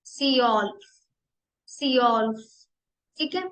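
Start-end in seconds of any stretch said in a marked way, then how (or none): 0.0-2.4 सियोल्फ said "tsyolf" with a rounded ö; सियोल्फ repeated for students; German zwölf. Shown as a demonstration. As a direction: model सियोल्फ carefully. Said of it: "twelve सियोल्फ".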